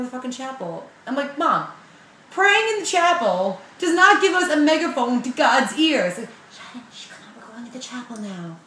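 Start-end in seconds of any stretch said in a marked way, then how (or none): 1.4-6.2 loud, high protest; exasperated argument. Retold or imitated. Arguing with mom. 6.5-8.6 whispered, firm hush; hushed scolding. Retold or imitated. Mom hushing at chapel.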